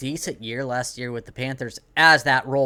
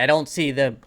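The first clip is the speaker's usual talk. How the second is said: speaking with the hiccups. Real, not acted. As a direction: talk through a hiccup. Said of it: "got the hiccups here".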